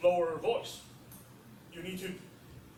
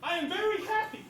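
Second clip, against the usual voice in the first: happy; 10 semitones higher than usual. Shown as a demonstration.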